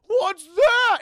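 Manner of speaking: very high, very loud acted alarm; hammed-up shout of fright; startled yell. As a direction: burst out in comic panic. Acts Wendell startled by zombie.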